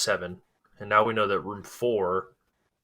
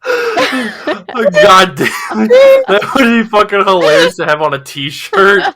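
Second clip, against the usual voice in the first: loud, laughing hard; cracking up, words through laughter; 11 semitones higher, 14 dB louder, slower than usual. Real, not acted. Cracking up at the joke.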